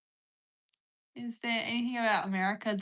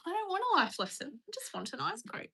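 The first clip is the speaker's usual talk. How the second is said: put-on upset child quote; 10 semitones higher than usual. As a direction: plead like an upset kid quoting a cartoon. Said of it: "If I get upset about something, I go".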